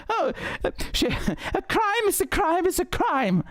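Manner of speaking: high, mocking posh Thatcher impression; Maggie Thatcher voice. Streamer mocking Thatcher's words to his viewers, high mock voice. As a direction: mimic the Iron Lady, high and prim. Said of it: "my Maggie Thatcher voice".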